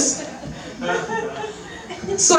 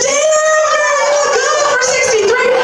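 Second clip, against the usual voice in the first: much higher, sassy compliment. Retold or imitated. Teasing her friend about sixty-three, sassy high compliment. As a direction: sing out a flattering compliment. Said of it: "I said".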